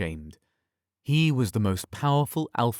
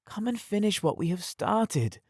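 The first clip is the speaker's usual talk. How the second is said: seductive; 11 semitones higher than usual. Acted. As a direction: lure him back in, teasing.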